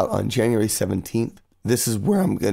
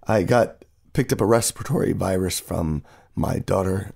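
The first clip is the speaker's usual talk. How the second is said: ordinary talk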